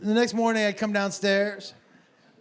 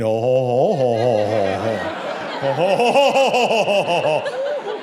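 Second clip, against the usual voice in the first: hammed-up "ho ho ho" laugh, starting low and climbing; mock teen laugh; 6 semitones lower, 4 dB louder, slower than usual. Comic imitating how the sixteen-year-old will laugh, mock guffawing. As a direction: put on a loud, exaggerated belly laugh. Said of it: "That's how he's gonna laugh".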